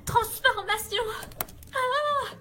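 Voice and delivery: high, shocked gasp as magic fails; startled cry. Acted. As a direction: cry out when the spell fails. Failed transformation, cries out.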